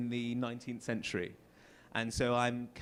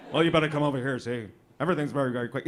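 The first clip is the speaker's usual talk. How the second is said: put-on fast-talking old film gangster, mild; forties movie gangster; 6 dB louder, much faster than usual. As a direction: rattle it off like a forties tough guy. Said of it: "speaks like they're a gangster".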